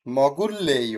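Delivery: "মগুল লেয়" with a long double l, no r; pronunciation demo. Shown as a demonstration. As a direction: lean on the joined l in "মগুল লেয়". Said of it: "লয় লয় হয়".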